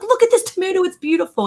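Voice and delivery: put-on very high, gushing kid voice; excited child. Six-year-old admiring a tomato to mom, excited. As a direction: marvel at it like an excited boy. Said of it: "He was excited".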